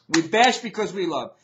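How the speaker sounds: firm, projected declaration; emphatic motto. Real, not acted. Vlogger signing off the bashing segment, emphatic and full-voiced. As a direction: proclaim it to the audience. Same motto, now proclaimed.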